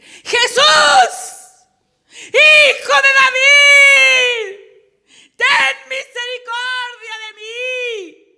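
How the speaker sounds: all-out cry for mercy over a crowd; sudden startling yell, every word stretched; desperate full shout. Acted. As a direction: bellow it high with long drawn-out words. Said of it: "Ahora voy a leer bien".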